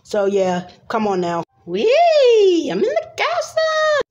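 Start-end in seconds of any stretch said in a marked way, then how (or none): 1.7-4.0 very high squealing joy; gleeful squeal. Acted. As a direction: shriek with delight as you zoom in. Character flies into castle.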